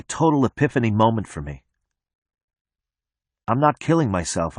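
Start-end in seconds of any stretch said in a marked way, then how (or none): none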